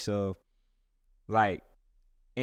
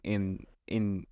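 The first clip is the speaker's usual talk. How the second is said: normal talking voice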